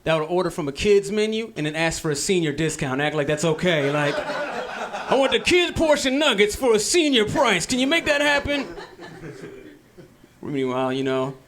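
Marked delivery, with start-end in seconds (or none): 4.2-8.7 put-on high, slow old-man voice asking for a deal; dad impression. Son mimicking his cheap dad at the counter, high slow old man. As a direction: imitate a cheap old dad sweetly haggling.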